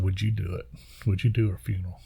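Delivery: retold very softly and low-key; quiet, tentative family plea; hushed request. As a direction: ask gently, barely above a murmur.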